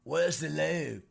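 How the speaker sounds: slow, loud mock English accent; drawn-out English accent question. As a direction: imitate an Englishman asking insistently. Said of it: "he kept asking me".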